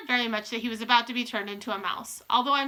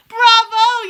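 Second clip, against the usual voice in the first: very high, loud cheer; jubilant, ringing shout; cheering yell; 12 semitones higher, 15 dB louder than usual. A narrator's reading. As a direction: yell it like a thrilled crowd. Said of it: "yelled the audience".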